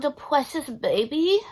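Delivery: soft, slow cooing at the dog; tender pet voice. Real, not acted. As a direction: coo over a sleepy puppy. Cooing over her sleepy dog.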